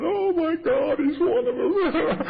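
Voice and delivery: very high, loud mock wail; mock crying. Mother on the phone learning about her son, hammed-up crying. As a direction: wail it in falsetto, drawing out the end. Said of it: "I heard her crying on the other end".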